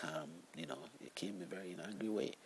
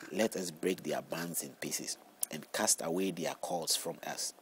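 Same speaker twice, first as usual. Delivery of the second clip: scripture reading; 5 dB louder than usual. Acted. Reads Psalm aloud.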